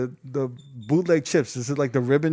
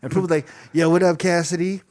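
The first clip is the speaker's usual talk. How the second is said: high, loud, excited kid-cheerleader impression; mock an over-eager hype man, pitched way up; gleeful high-pitched MC imitation; happy little kid; 5 semitones higher, 6 dB louder than usual. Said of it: "He sounds like a little kid just happy to be there".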